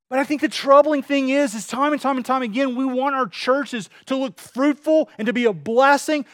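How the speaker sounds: sermon climax, pulpit fervour; fervent preaching. Acted. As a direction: press the congregation hard with conviction. Preaching at full force.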